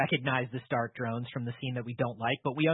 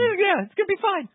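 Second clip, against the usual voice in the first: much higher, dismissive mock villain voice; 5 dB louder than usual. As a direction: wave off the danger like a careless villain. Villain brushing off casualties.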